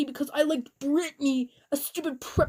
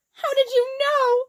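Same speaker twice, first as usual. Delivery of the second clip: high, loud shocked question; hammed-up surprise; 13 semitones higher, 6 dB louder than usual. A narrator's reading. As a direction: gasp it out in mock shock. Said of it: "I asked in a surprised way".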